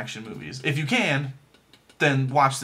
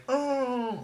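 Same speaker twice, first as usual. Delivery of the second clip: imitating the girl's sad oh; 11 semitones higher, 5 dB quieter than usual. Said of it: "she was like".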